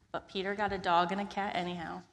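ordinary delivery, nothing marked

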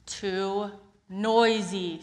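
slow, loud, weary complaint; drawn-out complaint. Acted. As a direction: stretch out the grumble. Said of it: "said Peter".

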